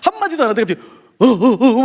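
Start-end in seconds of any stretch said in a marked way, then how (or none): 1.2-1.8 loud, repeated mock sobs; imitated sobbing. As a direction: imitate a man breaking down in heaving sobs. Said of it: "막 우는 거예요". (엉엉 우는 소리 흉내)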